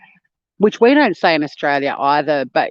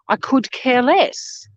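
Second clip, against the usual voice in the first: mock American phrase; 6 semitones higher, slower than usual. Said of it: "It's couldn't care less".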